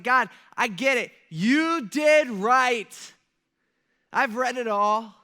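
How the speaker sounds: loud, slow and high plea to God; crying out. Retold or imitated. Daniel on his knees crying out to God, loud plea. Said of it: "he's kinda crying out".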